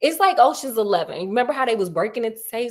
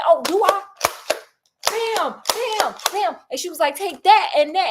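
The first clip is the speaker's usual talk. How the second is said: higher, sassy, taunting; mock vengeful taunt; 7 semitones higher than usual. Widow taunting the blackmailer as she shoots, vengeful. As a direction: taunt him as you pull the trigger. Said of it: "And she said".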